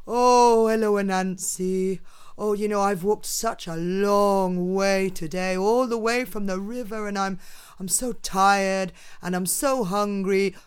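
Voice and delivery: loud, tired Turtle pleading; weary Turtle. Acted. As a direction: play a worn-out hungry turtle. Turtle voiced in story.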